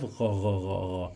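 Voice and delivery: "gha" with a throaty French r; French-R-like sound. Shown as a demonstration. Teacher demonstrates a sound absent in English, throaty r. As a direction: repeat "gha" on a voiced back-of-throat r. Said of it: "It sounds like R in French".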